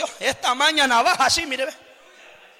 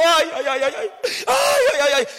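mock crying; 2 semitones higher than usual. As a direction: imitate someone bawling nonstop. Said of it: "no para de chillar en ese carro".

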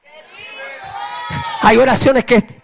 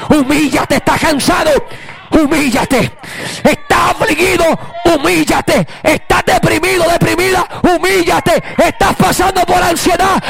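full-force preaching; 4 semitones higher than usual. Acted. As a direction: pound every line to the congregation.

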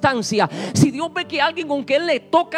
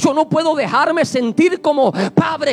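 rising sermon preaching; 5 dB louder than usual. Acted. Preacher builds to loud exhortation.

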